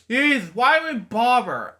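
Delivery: high-pitched exasperated outburst. Real, not acted. Reader butts in on the story, exasperated.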